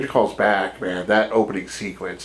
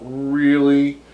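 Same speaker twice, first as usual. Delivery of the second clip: slow, drawn-out and higher, dripping with doubt; unimpressed sarcasm; 4 semitones higher, much slower than usual. Retold or imitated. Mocking audiences tired of remakes, slow and sarcastic. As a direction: stretch it out like you can't believe it.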